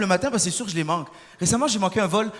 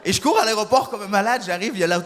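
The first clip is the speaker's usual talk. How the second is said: ordinary delivery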